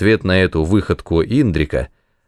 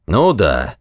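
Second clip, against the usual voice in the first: impatient dismissal, jumping high after the opening; sharp reply; 7 semitones higher than usual. A narrator's reading. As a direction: narrator voicing a curt official. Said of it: "резко ответил ему воевода".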